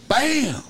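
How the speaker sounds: sudden loud, high bang; imitated door slam. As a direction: burst out like a slamming door. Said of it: "sounded like something had just slammed the door".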